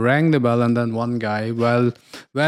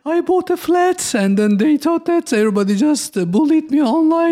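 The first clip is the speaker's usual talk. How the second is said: high, wailing mock sob, much higher and louder; mock crying; 3 dB louder than usual. Gen Z crying on TikTok, hammed-up mock sob. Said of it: "Gen Zs. They said".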